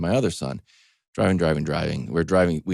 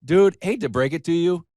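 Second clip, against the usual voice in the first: teasing; 7 semitones higher, 4 dB louder than usual. Retold or imitated.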